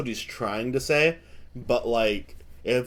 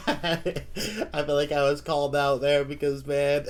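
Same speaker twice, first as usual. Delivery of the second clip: amused, laughing as you talk; 3 semitones higher than usual. Real, not acted. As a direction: talk through real laughter. Laughing at feeling called out.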